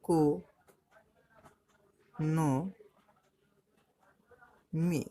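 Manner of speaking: each syllable of "economy" alone, long silences between; ই ... কো ... ন ... মি, one isolated beat each; split into syllables. Shown as a demonstration. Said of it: "সেটাকে সিলেবেলে ভাগ করে নেব".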